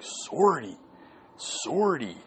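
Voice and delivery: high-pitched, swooping silly call; playful high squeal; mock catchphrase cry. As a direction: sing out the running gag, pitch up then falling. Friends' running-gag catchphrase acted out.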